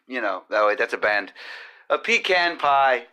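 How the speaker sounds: normal talking voice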